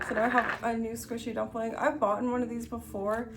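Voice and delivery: ordinary talk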